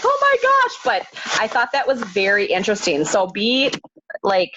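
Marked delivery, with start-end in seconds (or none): 0.0-0.8 surprised gasp. Retold or imitated. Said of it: "I was like".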